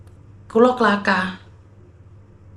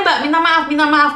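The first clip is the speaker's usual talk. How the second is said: usual speaking voice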